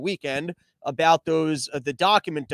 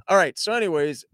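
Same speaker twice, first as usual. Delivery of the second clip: normal talking voice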